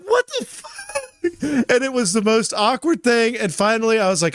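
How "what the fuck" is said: much higher, laughing disbelief; high laughing what the fuck; laughing shock. Retold or imitated. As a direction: squeak it out laughing. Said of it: "And I was like".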